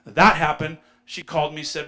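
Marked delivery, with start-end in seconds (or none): 0.1-0.8 repeated much louder; loud insistent repeat; emphatic. Shown as a demonstration. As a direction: hammer it home loudly.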